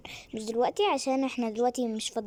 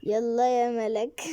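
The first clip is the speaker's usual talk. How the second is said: normal talking voice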